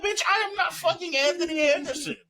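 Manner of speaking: high-pitched, exasperated comic protest; mock indignation. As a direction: replay an outraged denial for laughs. Retells his indignant retort to tourists.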